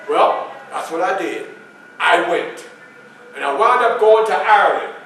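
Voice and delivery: ordinary talk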